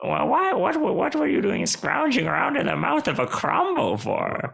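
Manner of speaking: high, incredulous creature voice; high-pitched critter asking in disbelief; excitable burrow creature. Acted.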